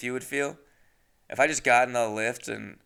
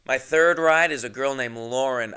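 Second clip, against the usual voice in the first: normal talking voice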